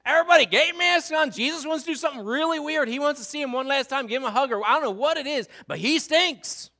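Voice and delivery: hammed-up Martha begging him to stop; exasperated Martha. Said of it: "so Martha says".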